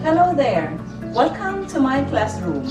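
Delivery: ordinary talk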